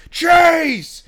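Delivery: high, loud yell of a name; shouted name. Retold or imitated. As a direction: bellow it to grab his attention. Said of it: "Dennis just goes".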